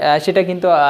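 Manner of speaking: normal talking voice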